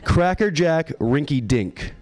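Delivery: slow, drawn-out Cracker Jack Rinky Dink; slow announcing. Shown as a demonstration. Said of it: "That's what everybody calls me".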